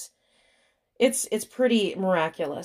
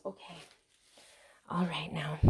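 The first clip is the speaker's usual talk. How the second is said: whisper; 10 dB quieter than usual. Real, not acted. Settling doll, hushed.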